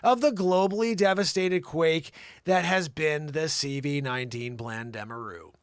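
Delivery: loud, high, over-punched newsreader; dramatic news read. Acted. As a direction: read it out like a booming report. Reads a news report aloud.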